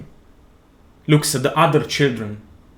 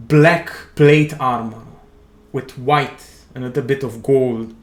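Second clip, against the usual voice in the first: usual speaking voice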